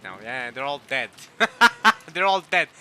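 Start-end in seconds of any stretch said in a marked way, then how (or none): 1.2-2.1 loud, high burst of real laughter; guilty laugh. Real, not acted. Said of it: "I shouldn't be laughing at that". 2.1-2.7 loud, higher, through real laughter; laughing. Real, not acted. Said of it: "I shouldn't be laughing at that".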